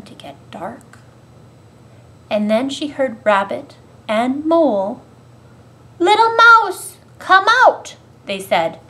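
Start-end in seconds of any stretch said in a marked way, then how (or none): 6.0-7.9 high, loud storybook call; raised, carrying call. A narrator's reading. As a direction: call a friend out of hiding. Friends calling Mouse out of hole.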